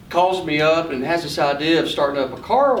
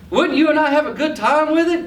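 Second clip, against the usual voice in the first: put-on coaxing little boy; 10 semitones higher, 3 dB louder than usual. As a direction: sweet-talk your uncle into a toy. Boy pitching the cheaper truck.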